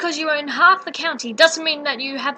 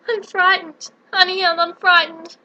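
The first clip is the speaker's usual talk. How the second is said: higher, louder, shaky fear; frightened; 4 semitones higher, 4 dB louder than usual. Acted. Dorothy locked up, calling out in terror. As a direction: plead in terror.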